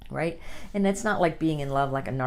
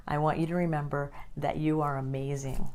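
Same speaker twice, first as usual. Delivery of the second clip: ordinary talk, nothing marked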